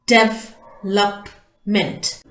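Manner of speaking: "Development" slowly as de-ve-lop, ment, in separate chunks; slow, broken-up "Development" with gaps between syllables; split into syllables. Shown as a demonstration. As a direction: sound out "Development" slowly, piece by piece. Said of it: "three syllables".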